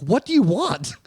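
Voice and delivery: high, exasperated what do you want; exasperated demand. Retold or imitated. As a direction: throw up your hands at him. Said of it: "me being like".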